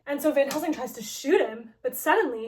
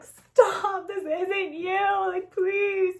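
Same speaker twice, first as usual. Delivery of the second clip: higher, crying plea; tearful plea; 7 semitones higher, 5 dB louder, much slower than usual. Retold or imitated. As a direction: beg him to come back. Heroine begs the hero to stop.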